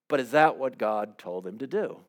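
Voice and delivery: ordinary talk, nothing marked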